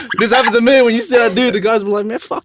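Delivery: high, loud, animated storytelling; worked-up retelling. Retold or imitated. Telling co-hosts what guys said back, worked-up retelling.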